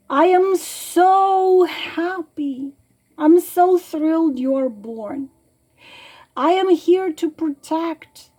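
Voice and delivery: tender letter reading, close to crying; near tears. Real, not acted. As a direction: read it as if the tears are coming. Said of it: "I'm gonna start crying".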